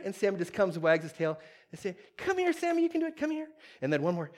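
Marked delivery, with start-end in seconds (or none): 2.2-3.5 high, encouraging pet-owner voice; coaxing a dog. Retold or imitated. Owner encouraging his stuck dog step by step, high coaxing voice. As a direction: urge the little dog on in high pitch.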